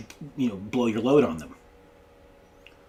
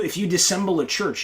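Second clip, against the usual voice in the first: ordinary talk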